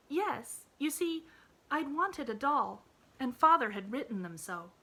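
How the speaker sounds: put-on bright, high little girl voice; eager child reassuring her friend; cheerful little girl. A narrator's reading. Pollyanna voiced in story.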